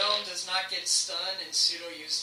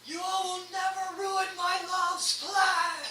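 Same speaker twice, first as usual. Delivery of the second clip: high, slow, grand villain cry; villain declaration; 13 semitones higher than usual. Acted. GM voicing the fighter hitting the gnome, grandly. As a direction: proclaim it like a zealous villain. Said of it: "he's all like".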